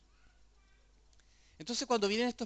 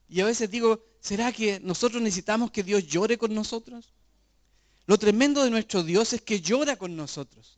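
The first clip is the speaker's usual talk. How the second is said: louder, driving preacher's voice; fervent preaching; 3 semitones higher, 6 dB louder than usual. Acted. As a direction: proclaim it to the congregation. Preaching peaks loud.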